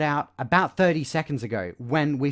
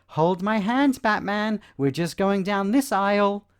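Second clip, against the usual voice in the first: higher, louder scolding parent voice; hammed-up parent telling a wandering kid to hold hands; mock parent; 8 semitones higher, 4 dB louder than usual. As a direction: play the fussing parent tugging a child along. Parent voice leading child Batman.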